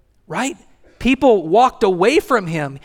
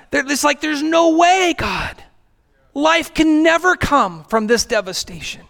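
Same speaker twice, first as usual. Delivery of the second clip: high, pained cry breaking into a shout on God; despairing cry to God; 8 semitones higher than usual. Retold or imitated. A hurting believer crying out to God, high and pained. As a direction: cry out like someone who has lost all hope.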